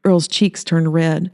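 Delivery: ordinary delivery, nothing marked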